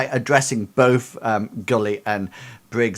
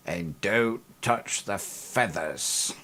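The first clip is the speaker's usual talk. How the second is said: stern; 2 semitones lower, 7 dB quieter, slower than usual. Acted. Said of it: "she looks very sternly at you".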